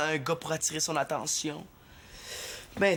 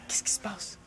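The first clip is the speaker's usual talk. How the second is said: whispering; 7 semitones higher, 4 dB quieter than usual. Acted. Sneaking to overhear.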